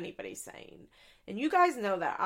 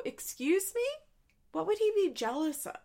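higher, indignant retort; 9 semitones higher than usual. Retold or imitated. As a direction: push back in disbelief. What Drew should have said.

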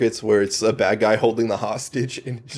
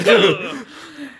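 sudden high-pitched laugh; high squeal laugh; 13 semitones higher than usual. Real, not acted. S1 laughs at stabbing.

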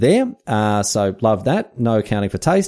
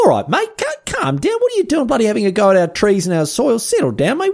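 much higher, squeaky affronted protest; mock indignation; 12 semitones higher than usual. Host scolds Darwin for knocking Australian trees, in hammed-up high-pitched indignation. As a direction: tell Darwin off like an offended local.

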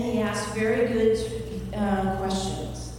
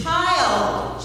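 stern mom call; 7 semitones higher, 4 dB louder than usual. Acted. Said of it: "She just goes".

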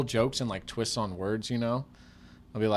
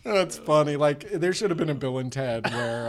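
ordinary talk, nothing marked

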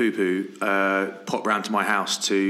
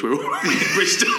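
laughing; 10 semitones higher, 4 dB louder than usual. Retold or imitated. Retelling laughing salesman's quote.

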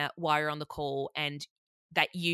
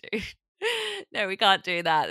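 laughing it off, louder on no; laughing protest; 4 semitones higher, 6 dB louder than usual. Retold or imitated. Friend laughing while refusing Romy's prank, louder. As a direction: giggle out a weak refusal.

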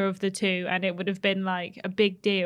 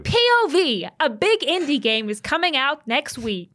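TikTok robot voice; 5 semitones higher, 3 dB louder than usual. Retold or imitated. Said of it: "like a TikTok robot was hosting".

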